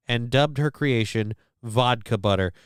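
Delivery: ordinary delivery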